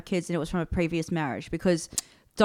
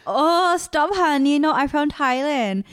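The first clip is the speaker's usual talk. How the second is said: high, raised mock protest; exasperated mum; 7 semitones higher, 4 dB louder, slower than usual. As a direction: correct them with playful exasperation, pitched up. Acting out mum being asked.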